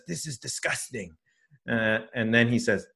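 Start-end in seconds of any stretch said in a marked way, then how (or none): 0.1-1.2 put-on much softer French-accented aside; hushed French accent, almost to himself; imitating a French accent, hushed; quiet French accent. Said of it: "in English with a French accent".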